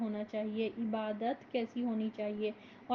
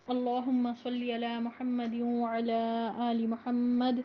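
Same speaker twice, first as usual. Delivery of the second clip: slow, measured recitation of the opening prayers; formal Arabic recitation; 3 dB louder, slower than usual. Acted. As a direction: recite slowly and reverently, durood swelling louder. (آہستہ، باوقار عربی تلاوت)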